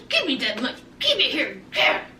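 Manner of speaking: grabby mock demand, low; imitating Destiny. Destiny snatches Sean's money, low mock demand. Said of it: "She was".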